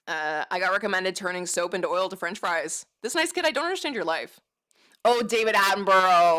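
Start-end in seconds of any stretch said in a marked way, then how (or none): 5.0-6.4 loud, excited call-out of a name; excited exclamation. Real, not acted. Streamer spots a request in chat, excited exclamation. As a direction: exclaim it loudly, delighted recognition.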